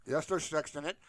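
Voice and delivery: ordinary talk, nothing marked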